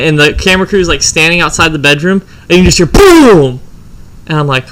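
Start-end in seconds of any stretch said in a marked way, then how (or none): 2.9-3.6 loud, drawn-out boom; imitated gunshot boom. Gunshot heard from outside the bedroom, loud imitated boom. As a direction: boom it out like a gun going off.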